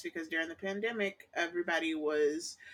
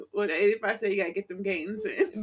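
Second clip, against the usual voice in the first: ordinary talk, nothing marked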